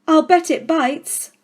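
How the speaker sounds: put-on high, slow, excited child; a little girl teasing the headmistress in mock alarm; Lavender crying out. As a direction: squeal a gleeful warning. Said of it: "cried Lavender".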